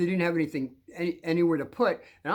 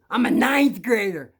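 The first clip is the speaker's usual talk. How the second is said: loud, slow, raised boast; intimidating bully; 4 semitones higher, 8 dB louder than usual. Retold or imitated. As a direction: imitate a bully pulling rank. Bully asserts his rank.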